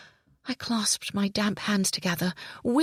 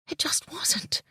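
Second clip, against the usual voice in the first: whispered denial; 8 semitones higher, slower than usual. A narrator's reading. Narrator denying to herself.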